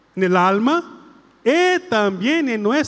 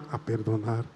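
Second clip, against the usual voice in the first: much lower and softer a perdonar; hushed echo; 11 semitones lower, 11 dB quieter than usual. Shown as a demonstration. Priest models the girl's quiet echo of the prayer. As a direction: answer in a near murmur. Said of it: "Empezamos otra vez".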